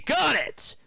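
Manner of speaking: a sudden high shout of joy; excited cheer. Real, not acted. Fan watching the game cheers the made three, high excited shout. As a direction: leap up as the shot drops.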